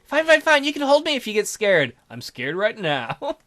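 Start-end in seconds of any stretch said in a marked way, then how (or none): 0.0-1.9 high, breezy reassurance; pitched-up teasing offer; girl character. Acted. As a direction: give in playfully in a girl voice.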